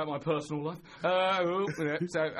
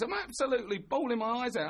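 normal talking voice

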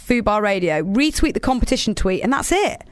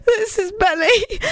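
high laughing retry; 12 semitones higher, slower than usual. Real, not acted. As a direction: laugh through the line. Laughing redo after voice slip.

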